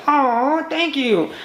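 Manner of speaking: high, sweet imitation of her thanks; mock girly thanks. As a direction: play the pleased girl, a touch hammed-up. The crush thanks him.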